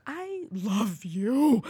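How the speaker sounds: I love you dragged out, word by word; extremely slow. Shown as a demonstration. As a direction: let each word of I love you hang. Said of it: "you can say a sentence a hundred ways".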